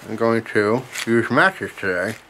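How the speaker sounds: talking around a pipe stem; mumbled with pipe between teeth; pipe in mouth. Real, not acted. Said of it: "if you didn't hear that".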